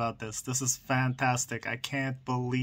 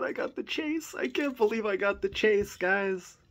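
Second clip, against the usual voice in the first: excited; 5 semitones higher than usual. Real, not acted. Finds the rare chase car.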